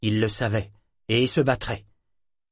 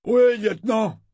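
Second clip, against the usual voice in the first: high, loud answer to an officer; eager shouted acknowledgment; 11 semitones higher, 5 dB louder than usual. A narrator's reading. Sailor answers his lieutenant before running off, calling out. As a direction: call back that the order is received.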